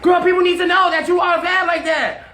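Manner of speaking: very high-pitched retold hyping-up; acted-out excited praise; gushing insistence. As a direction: hype a friend up emphatically. Retold insisting versus friend's refusal.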